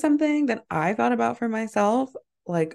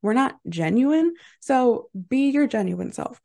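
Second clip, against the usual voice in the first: usual speaking voice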